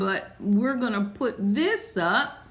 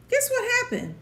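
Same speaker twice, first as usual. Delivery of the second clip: pitched up high, teasing ask; higher repeat; 11 semitones higher, much faster than usual. Shown as a demonstration. Preacher repeating the question to the church, much higher. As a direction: lift the question way up.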